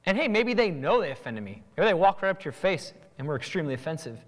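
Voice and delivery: ordinary talk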